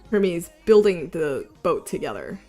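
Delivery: usual speaking voice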